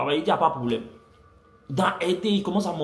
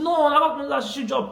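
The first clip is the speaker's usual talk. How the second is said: friends' quoted reply; 11 semitones higher, faster than usual. Retold or imitated. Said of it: "ils ont commencé à me dire".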